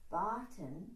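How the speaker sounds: accent the opening syllable of Batin; stress on first syllable of Batin. Shown as a demonstration. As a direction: punch the BA in Batin. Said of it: "accent on the first syllable".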